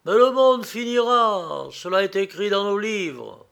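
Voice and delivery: stern prior laying down doctrine; implacable. A narrator's reading. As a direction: pronounce it as a merciless verdict. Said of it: "Le prieur impitoyable répondit".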